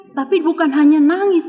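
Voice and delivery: ordinary delivery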